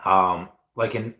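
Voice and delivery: ordinary talk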